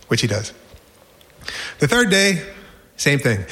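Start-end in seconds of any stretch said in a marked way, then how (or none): none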